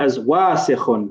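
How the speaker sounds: ordinary talk, nothing marked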